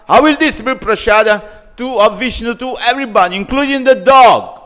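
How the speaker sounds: high, loud furious pronouncement; angry vow. Acted. Parvati cursing in her anger, a loud, high furious vow. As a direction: hurl it out as a curse. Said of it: "Then she became very angry".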